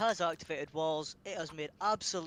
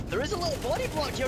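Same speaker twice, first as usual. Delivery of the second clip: excited; 12 semitones higher, 6 dB louder, faster than usual. Real, not acted. Sudden play call.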